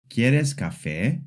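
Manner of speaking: "¿Quieres café?" with the voice rising on "café"; slow yes-no question, lift the end of "café". Shown as a demonstration. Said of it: "The voice rises at the end".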